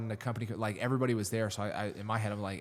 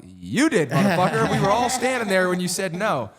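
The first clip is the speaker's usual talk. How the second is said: yelling back, much louder and higher; defiant retold shout at the officers who chewed him out; retold furious shout; 10 semitones higher, 8 dB louder than usual. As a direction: throw it back in the commander's face at full volume. Retold talking back at command.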